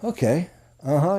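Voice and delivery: quiet encouraging listener noises; agreeable murmur. Shown as a demonstration. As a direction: murmur agreement to keep them saying yes. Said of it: "so you start to learn".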